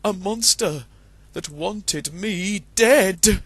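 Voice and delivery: high, slow, fearful rooster voice; frightened rooster. Acted. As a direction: shaken cockerel, dragging the last words. Chanticleer telling his dream.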